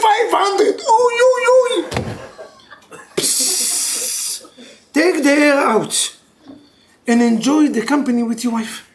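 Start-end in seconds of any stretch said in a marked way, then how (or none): 0.0-3.0 high-pitched, wailing stock lament; hammed-up wail, stretching the last words; mock crying. Rabbi imitating husband crying over stocks, mock wailing. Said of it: "you talking like this, you crying". 3.2-4.5 long deflating hiss; hissing air release. Shown as a demonstration. Rabbi telling workaholic to deflate, long hiss. Imitates air being let out.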